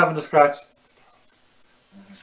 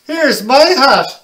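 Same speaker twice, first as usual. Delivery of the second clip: slow, high and louder, a mock chuckle in it; laughing dad; 10 semitones higher, 10 dB louder, slower than usual. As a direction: hand over the hat with a mild jolly laugh. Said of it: "laughed Dad".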